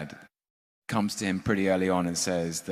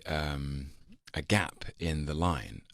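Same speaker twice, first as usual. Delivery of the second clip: ordinary talk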